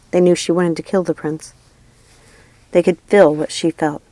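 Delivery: ordinary delivery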